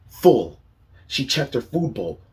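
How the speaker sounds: ordinary delivery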